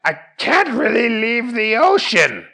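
high cartoon hero voice; Fish Guy. Fish Guy admits his limits to Jerry, high cartoon voice.

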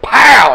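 loud pow; punchy sound effect. Acted. GM narrates punch.

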